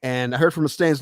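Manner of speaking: ordinary delivery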